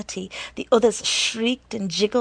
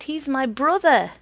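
raised, loud insistent call; yelling; 5 semitones higher, 6 dB louder than usual. A narrator's reading. The hat yelling from the stoop, insistent yell. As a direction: yell your claim down the street. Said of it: "yelling his special point".

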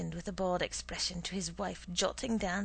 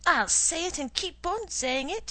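stubborn retort; 10 semitones higher, 7 dB louder than usual. Acted. Wife keeps arguing.

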